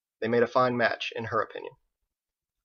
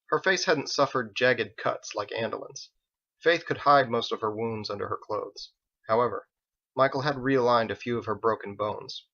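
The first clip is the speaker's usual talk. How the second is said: plain reading voice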